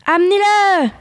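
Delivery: high-pitched narrated cry; shouted order. A narrator's reading. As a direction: call out the command high, at contained volume. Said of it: "cria la silhouette".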